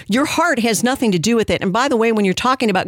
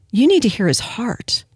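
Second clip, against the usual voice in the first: breathy, hushed fake sincerity; mock heartfelt plea; 3 semitones higher than usual. Host parodying the pastor's defenders, mock heartfelt. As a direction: plead for him with exaggerated tenderness.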